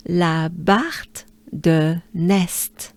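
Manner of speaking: La Barthe de Nest drawn out slowly in French; French place name. Shown as a demonstration. Teacher leading learners together, slow French La Barthe de Nest. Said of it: "So, as a whole, let's do it together".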